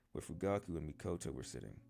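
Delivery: ordinary delivery, nothing marked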